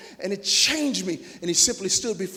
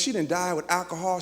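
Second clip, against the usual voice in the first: ordinary delivery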